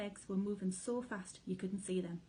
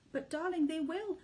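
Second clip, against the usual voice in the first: sobbing; 9 semitones higher, 6 dB louder than usual. A narrator's reading. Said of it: "sobbed Missus Fox".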